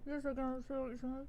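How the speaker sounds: very soft, quick muffled reply; muffled mask voice. Acted. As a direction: answer as if through thick cloth.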